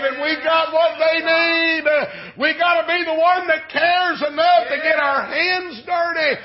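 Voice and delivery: rhythmic sermon crescendo; full-force preaching. Real, not acted. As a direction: preach it with mounting urgency. Preaches the application, building.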